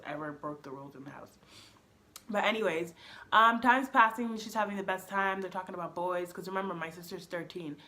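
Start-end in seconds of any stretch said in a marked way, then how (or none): none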